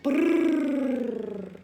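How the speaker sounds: rolled brrrr purr; purring sound. Shown as a demonstration. Reading a cat story, demonstrating a purr. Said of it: "he purred".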